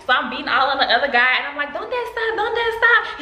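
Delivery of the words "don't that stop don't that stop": high-pitched mock yelling; retold frantic yell. Beating on the other guy, retold frantic yell. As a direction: re-enact yelling at him, pitched way up.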